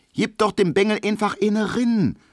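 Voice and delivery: high, excited Berlin woman's jeer; egging on a fight. A narrator's reading. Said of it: "sagt der uckermärksche Ackergaul". (hoch und keifend angestachelt)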